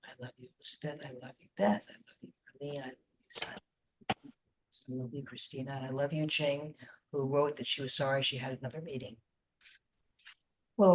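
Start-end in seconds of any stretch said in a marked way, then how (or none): 0.1-9.2 soft, hushed, loving words to each one; nearly a whisper, full of tenderness; very tender. Shown as a demonstration. Leader saying love to each group member, tenderly. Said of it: "say very tenderly".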